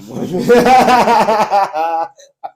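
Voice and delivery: long, loud, high cackle; loud burst of laughter. Real, not acted. Banter about rival club.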